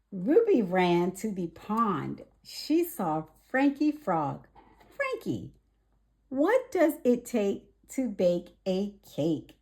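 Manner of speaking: lively storybook read-aloud; gentle children's story narration. A narrator's reading. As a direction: read it bright and clear for little ones. Reads picture book to children.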